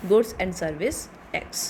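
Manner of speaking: very slow, word by word, as if for notes; slow dictation. Shown as a demonstration. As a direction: dictate each word so students can write. Teacher spelling out the term.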